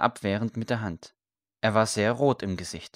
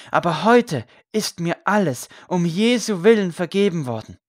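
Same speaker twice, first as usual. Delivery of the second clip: raised, high-pitched sermon voice; impassioned preaching; 7 semitones higher, 6 dB louder than usual. A narrator's reading. Condemned priest preaches forgiveness before his execution, loud earnest proclamation.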